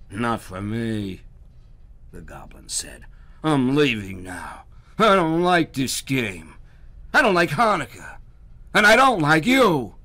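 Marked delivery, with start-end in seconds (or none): 0.1-1.2 slow, rough goblin refusal. Acted. Sulking goblin refusing Herschel, slow. 3.4-9.9 angry goblin. Acted. Goblin storming off from Herschel, loud and furious. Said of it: "the goblin grumbled, angry leaving".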